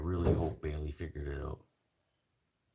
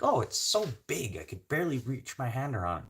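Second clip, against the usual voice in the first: mock impressed wife; 7 semitones higher than usual. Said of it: "Be like".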